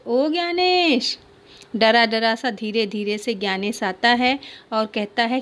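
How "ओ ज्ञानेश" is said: higher, slow drawn-out call. Acted. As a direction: call the boy from another room. Grandmother calling out boy. (दादी का पोते को खींचकर पुकारना)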